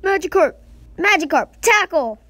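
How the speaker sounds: high, loud trainer's call; battle command. Pretend. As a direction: shout orders to your fighting creature. Calling commands to his Magikarp.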